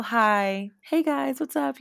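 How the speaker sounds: high, put-on friendly hello; smiley polite greeting. Greeting people she won't talk to, put-on smiley hello. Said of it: "the smile".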